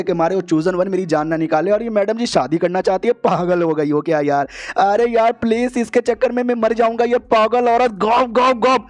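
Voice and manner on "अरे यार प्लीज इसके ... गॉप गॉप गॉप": panicked anger. Acted. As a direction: rage in panic about the crazy woman. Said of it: "ऐसा मनी मन गुस्सा करता है".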